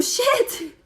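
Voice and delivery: calling the daughter for help with stuck hand, laughing; yelling across room. Retold or imitated. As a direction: call out across the store. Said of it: "I yell out across the room".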